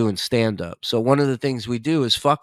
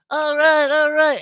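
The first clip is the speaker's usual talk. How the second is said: high, pumped-up crowd hype; hammed-up hype-man calls; mock hype; 4 dB louder than usual. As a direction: rev up an empty room. Imitating Scott hyping crowd.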